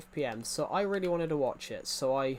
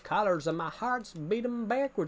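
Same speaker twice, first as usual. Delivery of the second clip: higher, slow put-on American accent; 7 semitones higher than usual. Shown as a demonstration. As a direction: show off your American accent, pitch climbing towards the end. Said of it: "Is my American accent all right?".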